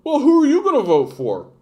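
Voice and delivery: high, indignant character squeal; Bubbles. Acted. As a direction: demand it in a high goofy voice. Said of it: "and he goes".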